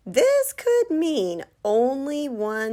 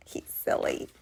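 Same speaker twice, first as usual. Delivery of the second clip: whisper; 5 dB quieter than usual. Acted.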